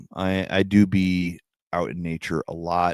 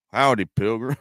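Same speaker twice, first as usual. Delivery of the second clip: John Wayne impression; 4 semitones higher, 4 dB louder than usual. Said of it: "my John Wayne impression".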